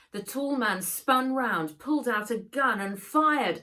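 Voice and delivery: ordinary delivery, nothing marked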